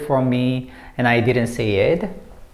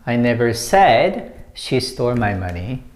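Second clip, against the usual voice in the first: "said" stretched and punched, the rest falling lower; stress on SAID; 3 semitones lower than usual. Shown as a demonstration. Teacher showing a new meaning through emphasis, stress on "said". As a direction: lean hard on the word "said". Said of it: "when you put emphasis on said".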